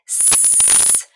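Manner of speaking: long hissing s through the teeth; drawn-out s like a snake. Shown as a demonstration. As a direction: hiss the S like a snake. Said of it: "Letter S sounds like a snake".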